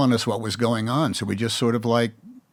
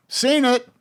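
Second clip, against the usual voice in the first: quipping fan; 13 semitones higher, 4 dB louder than usual. Retold or imitated. Fan's quip retold.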